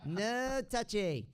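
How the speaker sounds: high, drawn-out comic refusal; David Spade. Shown as a demonstration. Voice double repeating the catchphrase for laughs, high drawn-out snooty voice. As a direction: stretch it slowly in a high snooty voice. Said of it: "Same with David Spade".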